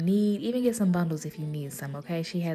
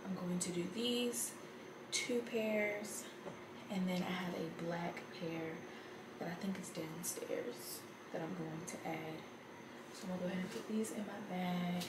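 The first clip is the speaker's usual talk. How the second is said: barely audible; 10 dB quieter than usual. Real, not acted. Keeps voice low late at night.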